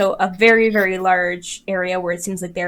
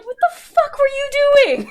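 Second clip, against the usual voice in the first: very high, strained disbelief; incredulous high squeak; 5 dB louder than usual. Acted. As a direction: ask it in a shocked high squeak. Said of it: "He's just like".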